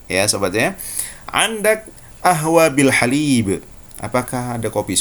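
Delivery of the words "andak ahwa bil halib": slow Egyptian Arabic, ahwa with a glottal stop; Egyptian Arabic accent. Shown as a demonstration. Teacher showing students the Egyptian qaf-to-hamzah change. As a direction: ask for milky coffee the Egyptian way. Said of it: "Qaf diubah menjadi hamzah".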